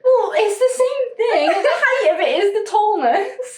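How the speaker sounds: high, slow, laughing protest. Real, not acted. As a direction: defend it while giggling. Arguing while laughing.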